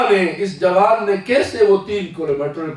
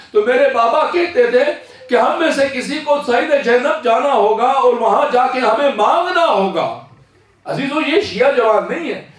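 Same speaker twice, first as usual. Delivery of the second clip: raised, fervent sermon voice; preaching at full force; 7 semitones higher, 4 dB louder than usual. Acted. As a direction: carry the youth's devotion to the crowd. Preacher voicing the youth's vigil.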